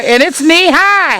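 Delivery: high, slow and thrilled; delighted sing-song. Real, not acted. Gardener bragging to viewers that her corn is knee high, delighted. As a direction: crow it out with glee.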